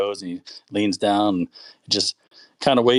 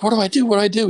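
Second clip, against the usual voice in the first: put-on panicked kid; 13 semitones higher, faster than usual. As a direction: beg for help in a high squeak. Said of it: "he's like".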